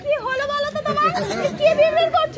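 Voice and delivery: quick, high-pitched query; rapid, high, puzzled scolding; puzzled questioning. Acted. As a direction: demand to know what's going on. Wife questions muttering husband.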